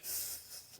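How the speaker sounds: unvoiced, very soft hiss; quiet sibilant sound effect; imitated psst. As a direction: mimic a move with a quick psst. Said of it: "then he'd be like".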